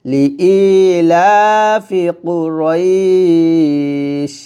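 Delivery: very slow, drawn-out লিইলাফি Quraish; slow Quranic recitation. Shown as a demonstration. Teacher modelling the stop for students, slow recitation. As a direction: recite with a long stretch and a clean stop. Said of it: "এরকম".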